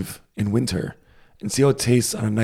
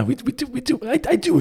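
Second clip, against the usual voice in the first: squeaky high; 13 semitones higher than usual. Real, not acted. Eager agreement, high squeak.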